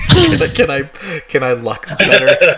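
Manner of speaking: mocking while laughing; giggling through the words.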